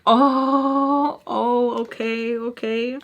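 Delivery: drawn-out, uneasy stalling; nervous. Retold or imitated. Woman hearing she is next to fight, nervous. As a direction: stretch it out as nerves creep in. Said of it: "I was so nervous".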